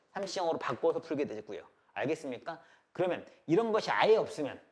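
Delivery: ordinary talk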